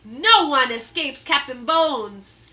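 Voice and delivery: loud menacing pirate boast; pirate roar. Acted. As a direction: threaten the kids. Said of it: "he roared".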